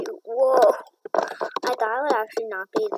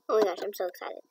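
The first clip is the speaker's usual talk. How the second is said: ordinary talk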